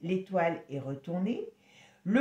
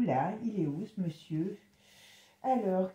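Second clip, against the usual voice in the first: ordinary talk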